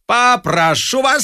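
high, slow ringing request; commanding sing-out; auctioneer's order. A narrator's reading. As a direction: draw it out, showing them the door.

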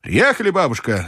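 high, mild cry; calling out. A narrator's reading. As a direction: call it up to the grandmother. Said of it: "закричал я".

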